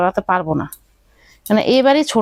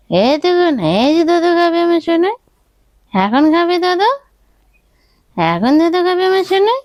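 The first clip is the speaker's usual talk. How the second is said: high, lilting motherese; baby talk; 5 semitones higher than usual. Acted. Mother coaxing her baby to drink his milk, in high lilting baby talk. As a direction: coax the baby to drink, sing-song and high.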